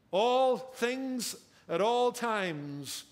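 "All" and "all" stretched out, very slow; stress on all. Shown as a demonstration. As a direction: lean slowly on each "all". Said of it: "Look at that word all".

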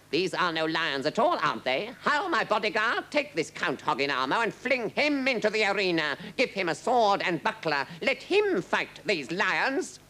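high, imperious royal command; taunt, then order the guards grandly; mocking king. A narrator's reading. King ordering his bodyguard to seize the count, imperious.

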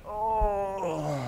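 high, strained moan of pain; a thin hurting cry; mock agonized whimper. Lawyer kicked between legs.

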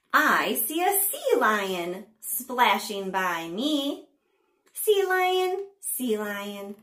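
slow, sing-song picture-book reading; punchy, drawn-out storytime narration; read-aloud to children. A narrator's reading. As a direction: read it to little ones with big pitch swings and pauses.

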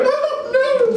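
very high, loud squeal of terror; hammed-up shriek at a little spider; mock panic. Acts his panic at a spider.

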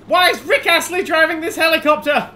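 high, loud mock outrage; incredulous. Real, not acted. Player spotting an odd pilot, loud high disbelief. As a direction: yell your disbelief at the screen. Absurd pilot model in game.

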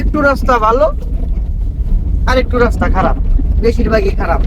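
loud, jolted by the rough road; on a bumpy road. Real, not acted. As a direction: talk over a bumpy ride. Driving on a rough dusty road.

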